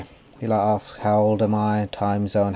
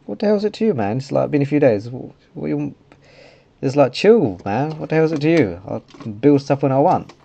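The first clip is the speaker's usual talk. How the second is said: higher, louder exasperated retort; annoyed comeback; 6 semitones higher, 5 dB louder than usual. Retold or imitated. Player telling realm owner to chill, exasperated. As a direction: push back, mock-indignant.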